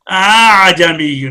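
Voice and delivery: اعجمی drawn out very slowly, the second hamza softened between alif and hamza; tasheel on اعجمی. Shown as a demonstration. Teacher demonstrating tasheel to students, slowly drawn out. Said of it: "اب دیکھیے".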